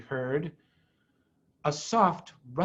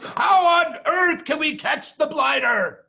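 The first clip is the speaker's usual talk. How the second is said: much higher and louder, demanding; exasperated farmer; 10 semitones higher, 6 dB louder than usual. Acted. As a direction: bark the question at the others. Boggis asks in read story.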